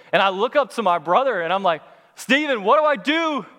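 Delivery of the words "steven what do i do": high, loud mock-desperate plea; panicked call. Climber calling up to his brother in a storm, acted panic. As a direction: call up for help in hammed-up panic.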